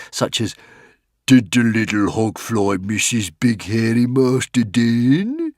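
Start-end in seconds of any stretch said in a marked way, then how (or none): 1.3-5.5 put-on slow baby talk to a pet; soft cooing. Warrior chieftain cooing to his pet hogfly, tender baby talk. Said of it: "making soft cooing, unwarrior-like noises".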